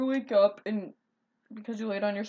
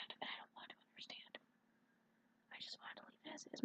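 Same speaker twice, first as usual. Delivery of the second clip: whispering; 5 semitones higher, 24 dB quieter, faster than usual. Real, not acted. Whispered aside about drawing.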